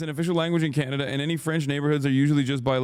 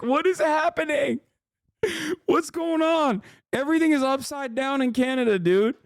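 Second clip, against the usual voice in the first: can't believe what he's reading; incredulous; 11 semitones higher than usual. Real, not acted. Incredulous at chat.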